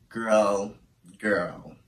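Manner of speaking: choked up. Real, not acted. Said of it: "I'm not about to get emotional".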